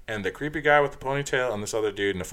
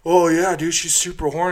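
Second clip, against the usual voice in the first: mocking bro voice; 8 semitones higher, 8 dB louder than usual. Mocking guys inside quote.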